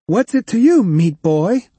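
high, loud mocking jab; snappy retort. A narrator's reading. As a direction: snap it at the nosy kid. Cyborg snaps at the kid.

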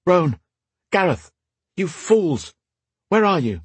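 high calling from afar. Acted. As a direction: shout across the forest for them. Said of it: "A muffled voice cried".

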